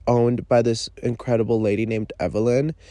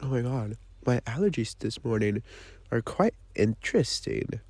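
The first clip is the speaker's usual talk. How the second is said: just swallowed coffee, softer; while sipping a drink; 2 semitones lower, 8 dB quieter than usual. Real, not acted. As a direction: talk right after a sip. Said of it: "let me take a sip of my coffee".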